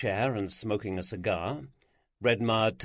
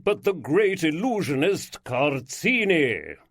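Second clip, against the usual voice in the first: slow, louder theatrical lord proclaiming; grand showman lord; 5 dB louder, slower than usual. Acted. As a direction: unveil himself like a stage magician. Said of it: "rich baritone voice with its aristocratic drawl".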